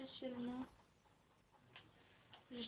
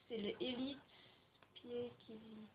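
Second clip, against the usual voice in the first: French-accented English; 9 dB quieter than usual. Real, not acted. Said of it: "Vive mon accent anglais".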